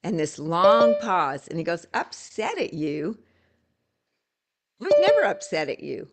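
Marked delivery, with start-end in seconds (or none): 1.9-6.1 imitating a baffled client, higher on the question; puzzled echo, then a louder, quicker denial; lightly acted disbelief; mock surprise. Acting out the client's puzzled reply.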